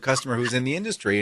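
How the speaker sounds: usual speaking voice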